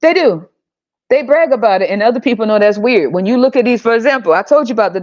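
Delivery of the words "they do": usual speaking voice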